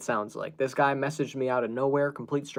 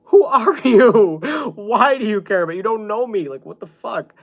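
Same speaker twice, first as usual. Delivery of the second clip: exasperated outburst; 9 semitones higher, 11 dB louder than usual. Retold or imitated. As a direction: retell it as a baffled, half-laughing yell. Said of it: "I got so angry".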